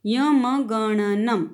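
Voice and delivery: यमगणनम spaced syllable by syllable; very slow syllable-by-syllable. Shown as a demonstration. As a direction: spell out यमगणनम slowly for the class. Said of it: "इसको हम बोलते हैं यमगणनम".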